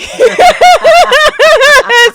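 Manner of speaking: loud, high cackling laugh; hearty laugh. Real, not acted. Storyteller cracking up at her short-dad joke, loud high laughter. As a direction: burst out laughing, real and uncontrolled.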